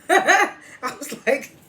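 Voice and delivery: high-pitched burst of real laughter; tickled laughter. Real, not acted. Recapper amused by the stank-breath line, laughing. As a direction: crack up, squeezing words out between laughs. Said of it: "but that was hilarious".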